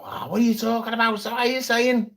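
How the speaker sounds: high mocking Scouse accent. Rich people scoffing at wealth tax, Scouse accent. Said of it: "why they've got Scouse accents".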